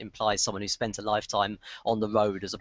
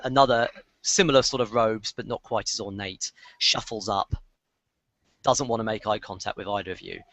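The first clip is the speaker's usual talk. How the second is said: ordinary talk, nothing marked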